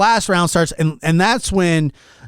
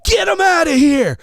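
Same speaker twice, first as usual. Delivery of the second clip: very high imitation of a yelling friend; hammed-up, high-pitched holler; retold frantic yell; 14 semitones higher, slower than usual. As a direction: mimic a fight fan losing it. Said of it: "And he's going".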